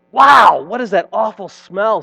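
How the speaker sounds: big loud high WOW, then easing off; hammed-up storybook outcry; cow's exclamation. A narrator's reading. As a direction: burst out in comic shock at a stink. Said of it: "said the cow".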